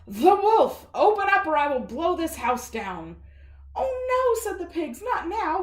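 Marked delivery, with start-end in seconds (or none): 0.0-3.2 loud wolf bellow; wolf threat. Acted. Wolf yelling at the brick house, loud threat. 3.8-4.4 squeaky, much higher pig voice. Acted. Pigs brushing off the wolf, squeaky high. 5.0-5.6 squeaky, much higher pig voice. Acted. Pigs brushing off the wolf, squeaky high.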